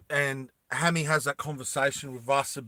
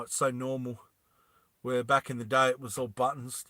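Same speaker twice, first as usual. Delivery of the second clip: usual speaking voice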